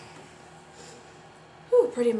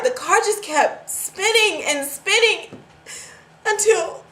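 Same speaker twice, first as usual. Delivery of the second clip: loud, higher-pitched frantic account; panicked retelling; 4 semitones higher, 10 dB louder than usual. Acted. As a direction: build from raised voice to high, panicked near-shout. Reliving the crash in panic.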